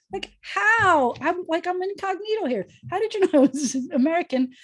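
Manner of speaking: mock surprise. Her own surprised reaction retold.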